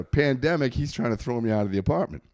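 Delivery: normal talking voice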